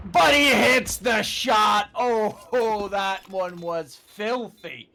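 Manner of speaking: loud, slow hype shout; excited shout. Real, not acted. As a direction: yell it as the shot lands. Caster erupts at clutch shot.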